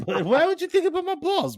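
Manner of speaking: high mock indignant question; teasing friend with fake outrage.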